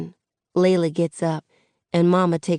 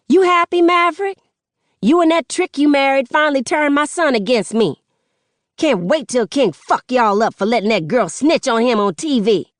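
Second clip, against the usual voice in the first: much higher, loud vicious parting shot; a mother lashing out at her ex while storming off; spiteful fury; 8 semitones higher, 4 dB louder than usual. Acted. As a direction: spit it at him on the way out.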